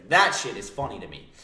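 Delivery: ordinary delivery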